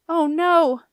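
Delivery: loud, high, alarmed cry; horrified exclamation, stretching "no"; gasping dismay. A narrator's reading. Boy suddenly realizing his mistake, aghast. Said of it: "Beetle gasped".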